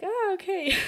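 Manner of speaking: high, mock-surprised little oh okay. Friend retelling a text exchange, mock surprised. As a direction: act out her own surprised reaction to his text.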